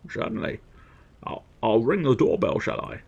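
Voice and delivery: slurred Scottish impression with shushed s; hammed-up Scottish film star; Sean Connery. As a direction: turn every s into sh, Scottish style.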